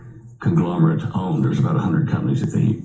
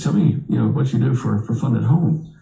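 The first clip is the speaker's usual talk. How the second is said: lightly imitated thick European accent, a bit higher; a vice president's thick European accent, hinted rather than full; 6 semitones higher than usual. Foreign vice president quizzing the narrator in the canteen, hint of a thick European accent. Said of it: "he has this thick European accent".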